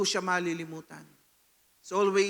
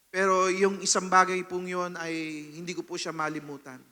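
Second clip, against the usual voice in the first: ordinary delivery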